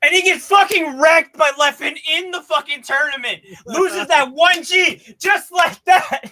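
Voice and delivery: high, worked-up recounting; excited outburst. Real, not acted. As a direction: raise the pitch and hammer out the loss, drawing out the finish. Incredulous retelling of a tournament loss.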